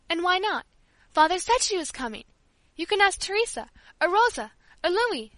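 put-on high, loud childish protest; a child standing her ground, loud; indignant protest.

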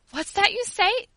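high, loud, startled question; shocked cry. A narrator's reading. Old servant reacts to being called heathen, shocked. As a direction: cry out in disbelief. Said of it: "cried poor Teresa".

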